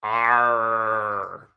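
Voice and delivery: loud pirate "Arr"; pirate growl. Pretend. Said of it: "it's called the Pirate Framework".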